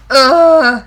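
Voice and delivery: loud frustrated groan; loud ugh. Retold or imitated. Fan groaning as the hero leaves, loud ugh. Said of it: "I'm like".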